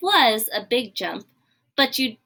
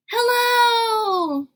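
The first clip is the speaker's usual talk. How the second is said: high, loud calling hello; excited shout; 11 semitones higher, 3 dB louder than usual. Acted. Piggy shouting hello down to Gerald, high and loud. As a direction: call it out from far up in the sky.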